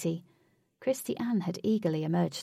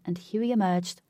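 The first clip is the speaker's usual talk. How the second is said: ordinary delivery, nothing marked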